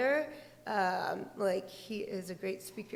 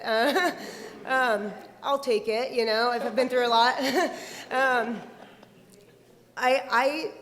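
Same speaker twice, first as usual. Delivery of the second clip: laughing it off, louder and faster; amused, laughing aside; 2 semitones higher, 8 dB louder than usual. Real, not acted. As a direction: chuckle through the self-deprecating joke. Elder joking about the word elder.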